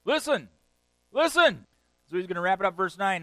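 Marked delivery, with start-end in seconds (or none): 0.1-1.7 loud, much higher call; commanding Jesus. Retold or imitated. Jesus calling the crowd to listen, loud. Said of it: "he says what?".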